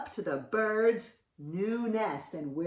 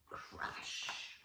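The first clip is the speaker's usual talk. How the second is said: usual speaking voice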